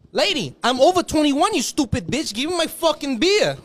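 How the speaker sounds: high, loud exasperated demand; irate bar customer; indignant complaint. Retold or imitated. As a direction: argue with the cashier for beer. Customer insults cashier.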